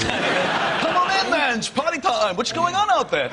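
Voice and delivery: high, hammed-up Dutch accent. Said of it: "That's a Dutch accent".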